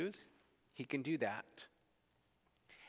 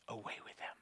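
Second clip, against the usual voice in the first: whispered crowd cry; 2 semitones lower, 12 dB quieter, faster than usual. Retold or imitated.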